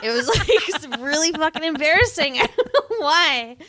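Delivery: high, loud, laughing through the words; real cracking-up while talking; laughing hard. Real, not acted. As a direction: barely get it out for laughing. Cracking up at embarrassing memory.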